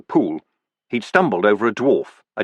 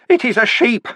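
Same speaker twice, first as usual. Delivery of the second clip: much higher and louder, like a picture book; childlike high recital; 12 semitones higher, 4 dB louder than usual. Acted. Vimes reciting his son's book to the dwarfs, high and loud. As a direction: recite it as if to a toddler.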